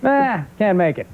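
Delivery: drawn-out, high dad refusal; slow, offhand brush-off as Dad; dismissive father. Retold or imitated. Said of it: "He went".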